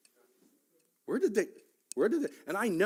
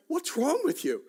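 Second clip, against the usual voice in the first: retold incredulity; 7 dB louder than usual. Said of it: "I just looked at her and I went".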